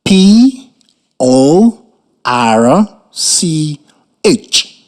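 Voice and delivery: letters "P", "O", "R C", "H" spaced apart; spelling it out. Shown as a demonstration. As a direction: dictate "P O R C H" letter by letter. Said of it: "write the word spelled".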